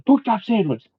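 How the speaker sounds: high-pitched, half-contained mock battle cry; mock shout. Buddy trading random words to make friend giggle, mock shout. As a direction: call out nonsense as a pretend war cry. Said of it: "that one could shout".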